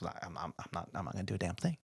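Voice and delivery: lazy attendant refusing to lift a finger, muttered; sullen mutter. Retold or imitated. Attendant's attitude voiced by teller.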